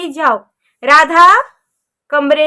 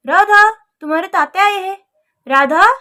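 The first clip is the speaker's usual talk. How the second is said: usual speaking voice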